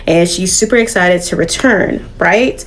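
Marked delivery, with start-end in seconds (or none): none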